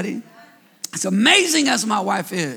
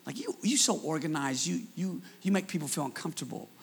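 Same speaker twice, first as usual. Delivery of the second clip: whisper; 4 semitones lower, 8 dB quieter than usual. Acted. Teasing the planners in the crowd.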